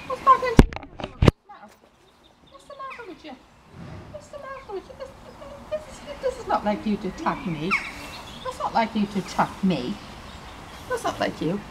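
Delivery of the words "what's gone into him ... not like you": 1 high coaxing scold. Real, not acted. Scolding stallion who attacked.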